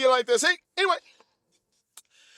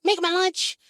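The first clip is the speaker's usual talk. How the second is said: lightly voiced child begging mama; child pleading. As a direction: plead please, small voice. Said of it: "I was like".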